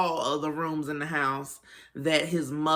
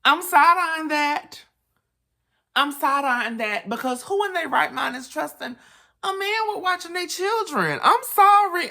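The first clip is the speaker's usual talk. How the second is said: very high, incredulous side-eye; high-pitched suspicion; 10 semitones higher, 4 dB louder than usual. Real, not acted. Host doubts anyone trusting a man with children, high-pitched suspicion. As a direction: squeal your disbelief way up high.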